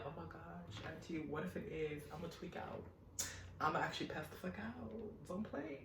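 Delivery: mock-terrified murmur to auntie; hushed pleading; hushed panic. Scared aside to auntie.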